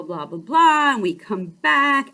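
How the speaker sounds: ordinary talk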